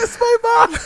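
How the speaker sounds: high, laughing squeal. Real, not acted. Laughing at the story.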